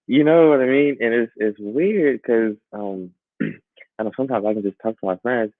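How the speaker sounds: usual speaking voice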